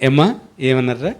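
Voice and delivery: ordinary delivery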